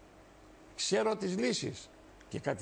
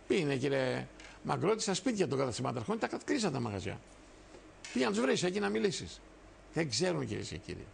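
ordinary talk

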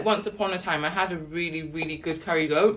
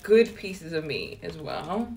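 ordinary delivery

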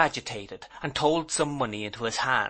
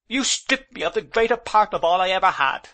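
high, louder, tearful indignation; indignant tears; 8 semitones higher, 5 dB louder than usual. A narrator's reading. Aunt accusing her husband of ruining her, tearfully indignant. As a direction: accuse him through rising tears. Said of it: "my aunt's indignant tears".

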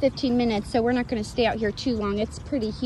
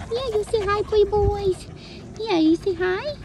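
pet talk; 8 semitones higher than usual. Real, not acted. Urging the animals to greet.

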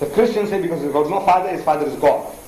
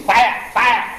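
a raised warning cry, acted out in the lecture; mock shout; 5 semitones higher, 4 dB louder than usual. Lecturer acting out a stranger's alarm, mock shout. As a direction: call out fire as if warning a crowd. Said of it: "somebody shouts in Chinese".

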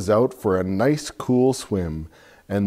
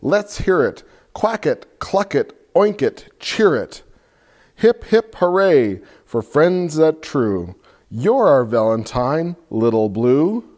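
cheering; 6 semitones higher, 6 dB louder than usual. Acted. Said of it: "croaked Toad".

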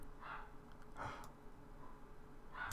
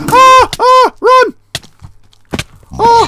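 shrill panicked screaming, much higher and louder; terrified screams; 20 dB louder, much slower than usual. Real, not acted. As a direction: scream for your life as it charges you. Mob attacks in dark cave.

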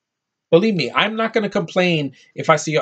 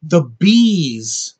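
incredulous emphasis; 4 dB louder than usual. Real, not acted. Mocking a stupid plot point.

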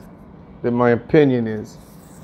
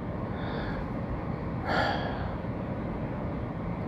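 long weary sigh; 15 dB quieter than usual. Real, not acted. Stressed tenant sighs.

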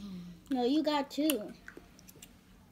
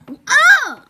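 high loud surprised oh; surprised shriek; 10 semitones higher, 14 dB louder than usual. Real, not acted. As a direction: shriek in alarm. Hears stinky socks option.

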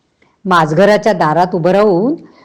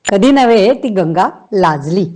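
usual speaking voice

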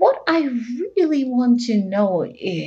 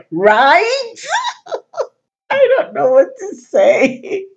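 higher, louder, giggling through words; laughing; 4 semitones higher, 4 dB louder than usual. Real, not acted. Host giggling to kids about sneaky parents, laughing. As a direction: talk while cracking up.

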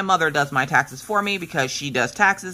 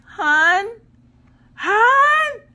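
high, loud calling through the door; calling for husband from behind a door, rising; 5 dB louder than usual. Retold or imitated. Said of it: "So I'm in there struggling".

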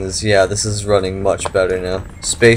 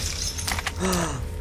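quiet delighted gasp; soft gasp; 7 semitones higher, 15 dB quieter than usual. Real, not acted. Finds ammo crate.

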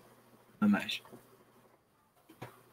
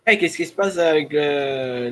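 ordinary talk, nothing marked

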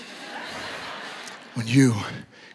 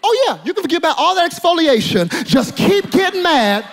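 high, ringing sermon delivery; impassioned preacher in full flight; full-force preaching; 10 semitones higher, 4 dB louder than usual. Real, not acted. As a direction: hammer each warning at the congregation.

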